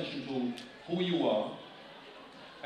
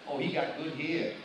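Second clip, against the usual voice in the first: imitating a girl; 2 semitones lower than usual. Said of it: "They're gonna say".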